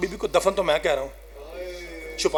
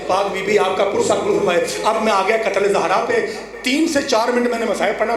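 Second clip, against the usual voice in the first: ordinary delivery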